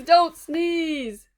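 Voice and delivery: plain reading voice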